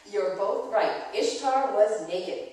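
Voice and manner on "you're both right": ordinary delivery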